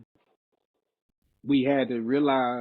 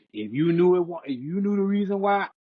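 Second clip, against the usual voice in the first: higher, rising scolding; indignant rebuke; 6 semitones higher than usual. Retold or imitated. Man rebuffing partner's late apology, higher and indignant. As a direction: build into a heated telling-off.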